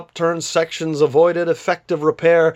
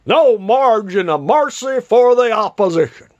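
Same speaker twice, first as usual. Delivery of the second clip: blustering politician; 6 semitones higher, 4 dB louder than usual. A narrator's reading. Pompous politician complaining.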